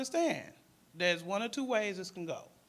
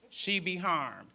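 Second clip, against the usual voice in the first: ordinary talk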